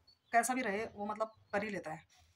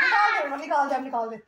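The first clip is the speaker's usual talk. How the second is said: calling out; 5 semitones higher, 13 dB louder than usual. Real, not acted. Mother calling loudly to her child to take it off. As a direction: shout it across the room.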